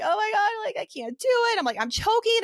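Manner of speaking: high, whimpering retold meltdown; imitated crying. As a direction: mimic yourself crying in panic. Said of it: "And I'm, like, crying".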